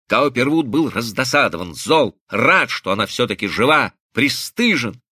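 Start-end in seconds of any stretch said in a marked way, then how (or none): none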